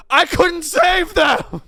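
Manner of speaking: slow, high hammed-up wailing shout; mock anguished wail. Traumatized controller to new trainee, hammed-up wail. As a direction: howl it out in mock despair.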